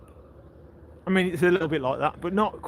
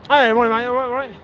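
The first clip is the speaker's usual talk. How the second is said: calling out greeting; 7 semitones higher, 9 dB louder than usual. Real, not acted.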